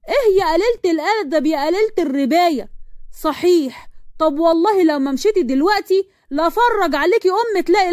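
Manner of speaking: high-pitched scandalized scolding; outraged mother. Acted. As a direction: rail at her with rising threat. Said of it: "أم مروان شهقت جامد قوي".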